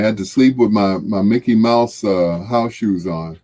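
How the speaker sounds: ordinary talk, nothing marked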